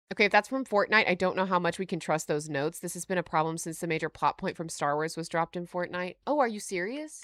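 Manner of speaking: ordinary talk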